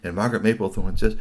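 usual speaking voice

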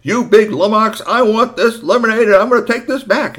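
put-on high, loud, bossy kid's demand; a small boy insisting on his lemonade, loud and high. Storyteller voicing the boy bossing Bigfoot, loud and high. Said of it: "Instead, he said".

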